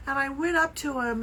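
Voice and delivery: usual speaking voice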